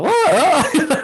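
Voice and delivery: high cracking wavering vocal squeak, half laughing. Retold or imitated. Singer's voice cracking mid-chant in front of people, imitated squeak. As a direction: let the singing voice break upward into squeaks. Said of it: "my voice was going like".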